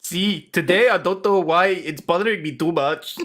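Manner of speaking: broken English in a put-on Spanish accent; mock Spanish accent. Juror explaining to the judge, mock Spanish accent. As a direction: play a juror with a thick fake accent. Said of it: "Now you've gone Scottish".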